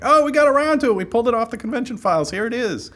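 high, quick, chirpy retelling; mock breezy announcement. Sound guys finally delivering the files, high mock-cheery. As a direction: pitch it way up and rattle it off.

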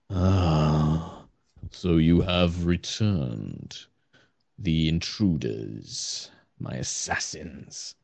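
very slow, low and hushed, trailing away; slow, sinking voice of a suspicious celestial being; weary wary celestial. Acted. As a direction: draw each phrase out, low and ominous. Game master voices weary celestial figure.

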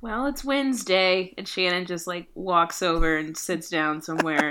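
ordinary talk, nothing marked

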